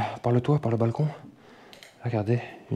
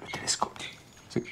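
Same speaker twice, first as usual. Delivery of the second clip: whispering; 14 dB quieter than usual. Real, not acted. Hushed look round abandoned house.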